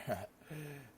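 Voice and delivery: high, light acted laugh. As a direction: let out a brief high chuckle. Character laughs after teasing.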